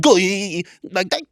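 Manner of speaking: high, drawn-out silly squeal; dumb warm-up noise. Retold or imitated. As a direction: imitate silly clown warm-ups. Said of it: "they're all being really dumb".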